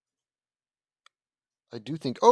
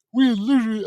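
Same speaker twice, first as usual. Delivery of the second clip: words stretched by a yawn; 10 semitones higher, 11 dB louder than usual. Real, not acted. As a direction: say it mid-yawn. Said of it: "I'm yawning".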